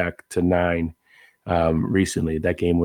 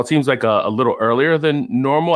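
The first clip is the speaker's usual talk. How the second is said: normal talking voice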